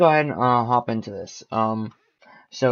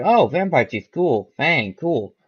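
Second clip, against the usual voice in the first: excited; 5 semitones higher, 5 dB louder, slower than usual. Retold or imitated. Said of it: "so I was like".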